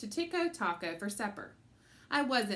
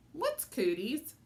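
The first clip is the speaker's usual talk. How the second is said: put-on slow child voice; 6 semitones higher, slower than usual. A narrator's reading. Fudge repeats question.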